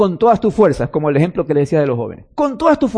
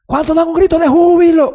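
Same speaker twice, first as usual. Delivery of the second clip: high, loud rallying call across the room; calling out to a crowd; 11 semitones higher, 5 dB louder than usual. Retold or imitated. Youth leader calling to teens at lunch, loud rallying call. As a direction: rouse the crowd for a cheer. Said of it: "la voz de quien les hablaba".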